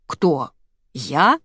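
understated, baffled double question; astonished. A narrator's reading. Man baffled at being doubted, mildly astonished. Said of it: "изумился мужчина".

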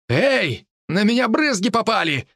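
high indignant yelp; protest, high and sharp. Acted. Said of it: "возмутился один из них".

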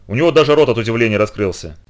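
ordinary delivery, nothing marked

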